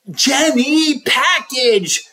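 high teacher voice. A narrator's reading. Teacher calls spelling word.

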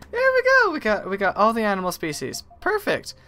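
high, loud burst of excitement; triumphant. Real, not acted. Player completes the animal species collection, triumphant. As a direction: cheer a completed collection, pitch leaping up.

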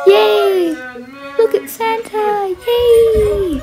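high, squealing happy shout; excited cheer. Real, not acted. Kid seeing Santa on screen, excited cheer. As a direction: cheer for Santa at the top of your voice.

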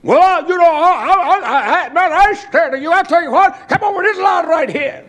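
high, loud laughing bluster; high shouted mock bravado; nervous bluster. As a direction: fake bravado while scared. Bully's nervous bluster voiced.

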